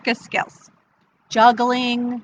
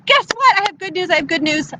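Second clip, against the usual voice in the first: high-pitched, eager announcement; excited news; 8 semitones higher, faster than usual. Acted. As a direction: burst in eager to share news. Woman brings mice good news.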